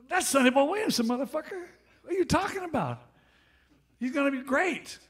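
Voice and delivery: high, loud indignant retort; imitating an exasperated shout of defense; indignant outburst.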